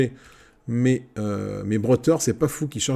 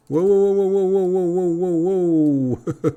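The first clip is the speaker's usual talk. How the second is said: loud, high rapid-fire run of wows; high, loud cry to halt, drawn out at the end; alarmed stop call; 7 semitones higher, 5 dB louder, slower than usual. Real, not acted. Player halting his runaway troops, alarmed.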